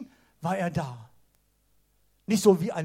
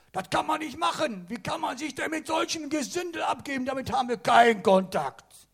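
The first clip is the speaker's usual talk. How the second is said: high, scandalized protest of the theologians; mock outrage at Jesus sitting with sinners; indignant religious leaders; 6 semitones higher, 4 dB louder than usual. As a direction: sound like appalled scribes clutching pearls.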